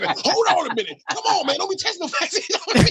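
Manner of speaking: high, pleading mock panic; imitating the Surgeon General begging not to be tested; panicked protest. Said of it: "He's like".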